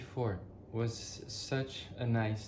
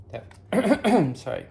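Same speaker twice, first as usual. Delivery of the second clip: choking mid-word; 7 semitones higher, 8 dB louder, slower than usual. Real, not acted. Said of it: "I'm choking".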